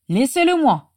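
high, loud claim; demanding. A narrator's reading. Rodolphus claiming Régulus for himself, demanding. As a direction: call out to claim the prey. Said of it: "réclama Rodolphus Lestrange".

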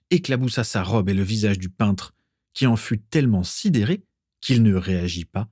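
plain reading voice

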